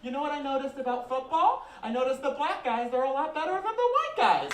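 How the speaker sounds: put-on young girl chattering about football; little daughter's voice. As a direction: say it like an innocent kid noticing something. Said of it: "She goes".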